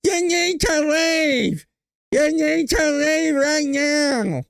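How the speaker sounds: high, slow, shrill mock shout of the line; Karen Bass impression. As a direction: ham up the mayor ordering them out, high and drawn out. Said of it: "who the fuck do you know that talks like that?".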